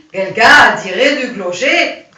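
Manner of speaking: a heavy put-on German accent. Shown as a demonstration. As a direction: say it like a German soldier with a thick German accent. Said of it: "il dit ... comme ça".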